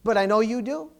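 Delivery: pleading prayer. Pretend. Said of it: "You say".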